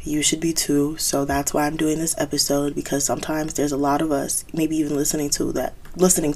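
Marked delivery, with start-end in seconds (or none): none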